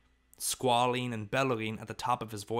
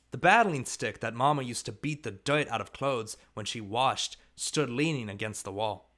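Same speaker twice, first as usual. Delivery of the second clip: usual speaking voice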